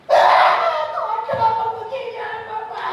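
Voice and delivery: high mock whine to God; whining complaint. Mocking a whining believer. (rengekan tinggi pura-pura)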